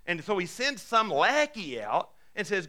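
ordinary talk

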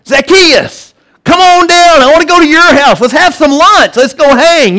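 high, loud, mirthful call with a smile; joyful, winsome. Acted. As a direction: call up to a friend in delight. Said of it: "with this kind of smile, mirthy, joyful, winsome thing going".

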